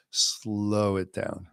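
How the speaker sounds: long drawn-out SLOW, very unhurried; slowed right down. Shown as a demonstration. Teacher showing students how to catch a thought, drawn out. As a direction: stretch the word slow way out.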